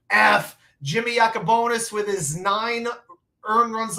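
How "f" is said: loud. Pretend. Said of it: "from the second row".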